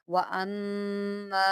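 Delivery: व अम्मा slowly, nasal mm then a long ma; ghunna and long ma. Shown as a demonstration. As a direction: hum the mm and stretch the ma in व अम्मा. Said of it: "गुन्ना भी करें मीम में और इसको खींचे भी".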